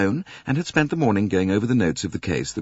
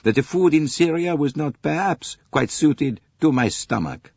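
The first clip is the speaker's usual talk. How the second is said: Poirot voice; 9 semitones higher, 4 dB louder than usual. Acted. Poirot agrees wryly.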